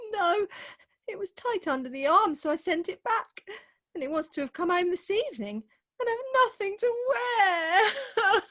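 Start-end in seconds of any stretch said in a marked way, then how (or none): 6.0-8.4 high, slow crying line; tearful whimper. Acted. Bride crying to her family that she has nothing to wear, tearful. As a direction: let it break into a sob.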